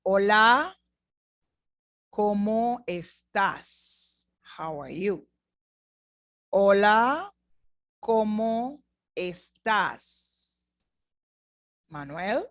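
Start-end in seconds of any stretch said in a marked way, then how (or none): 0.0-4.3 slow and clear for repeating; slowed-down question; slow model. Shown as a demonstration. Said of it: "Linda y Manuel". 6.5-10.8 slow and clear for repeating; slowed-down question; slow model. Shown as a demonstration. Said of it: "Linda y Manuel".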